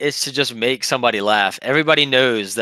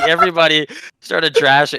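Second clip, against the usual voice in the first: ordinary talk, nothing marked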